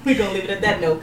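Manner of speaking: ordinary talk, nothing marked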